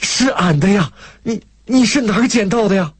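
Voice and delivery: high, amazed country woman; delighted surprise. A narrator's reading. Country woman asking where her ticket was found, delighted surprise. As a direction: stammer slightly with happy amazement. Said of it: "大嫂霎时惊喜".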